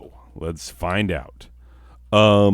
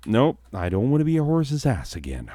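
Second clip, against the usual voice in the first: normal talking voice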